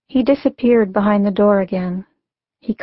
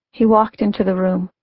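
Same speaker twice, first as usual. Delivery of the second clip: usual speaking voice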